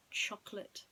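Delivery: usual speaking voice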